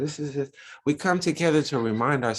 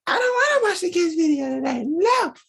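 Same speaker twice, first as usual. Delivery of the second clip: high, loud mock child refusal; daughter's voice; 4 dB louder than usual. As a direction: play the stubborn little girl saying no. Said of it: "And she says".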